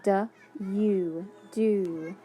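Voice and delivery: "du" then "do" blended; sounding out. Shown as a demonstration. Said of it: "long U, sneaky E".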